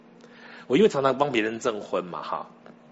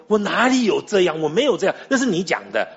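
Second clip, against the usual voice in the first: raised, louder mock protest; indignant protest; 5 semitones higher, 3 dB louder than usual. Bride objecting to her fiancé's complaint, indignant and louder. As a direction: bristle back, denying it outright.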